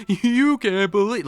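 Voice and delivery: Jerry Seinfeld impression. Streamer mimics the comedian's voice in his head, hammed-up. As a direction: do a quick Seinfeld bit. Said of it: "because I hear Jerry Seinfeld".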